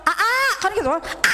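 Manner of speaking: high call of "A, A" to a husband; wife calling husband. Acted. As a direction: call out to your husband at home. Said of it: "Ibu pami di bumi nyauran caroge kumaha".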